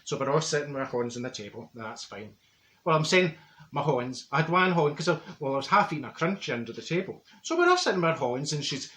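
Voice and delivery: normal talking voice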